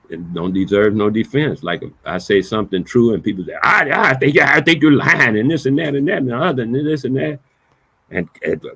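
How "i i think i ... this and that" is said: loud, high-pitched imitation of a heckler; hammed-up protest, raised and hurried; mock accusing. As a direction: mimic an agitated accuser rattling on. Mimics people accusing him of lying.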